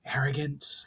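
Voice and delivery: a spirit breathing the ancient greeting; ghostly whisper. Acted. Spirit greeting Valinar in old tongue, ghostly whisper.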